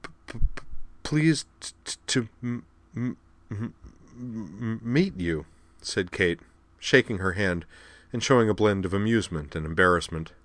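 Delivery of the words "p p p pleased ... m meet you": halting, repeated first consonants; nervous stammer. A narrator's reading. Kate greeting her new acquaintance, stammering. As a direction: get stuck on each word's first sound.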